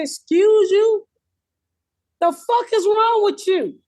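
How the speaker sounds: high, slow, outraged "excuse you"; indignant comeback. Retold or imitated. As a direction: stretch it out, offended and high. Retold comeback to a heckler.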